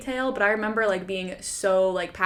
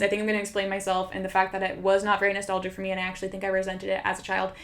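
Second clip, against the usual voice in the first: usual speaking voice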